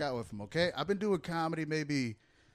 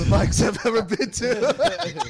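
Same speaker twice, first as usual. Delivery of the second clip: laughing; 5 semitones higher, 8 dB louder, much slower than usual. Real, not acted. Laughing while roasting the spot.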